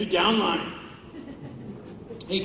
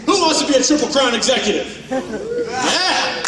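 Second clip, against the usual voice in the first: loud, laughing hype-man call; rally the room like a cheesy MLM event host; mock hype rally; 6 semitones higher, 5 dB louder than usual.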